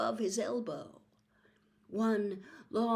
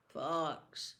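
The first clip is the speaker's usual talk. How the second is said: thickly; 6 semitones lower, 7 dB quieter than usual. A narrator's reading. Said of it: "said Harry thickly".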